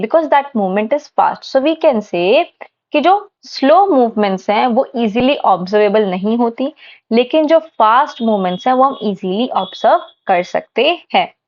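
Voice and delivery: normal talking voice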